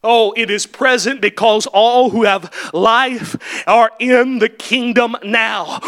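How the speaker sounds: usual speaking voice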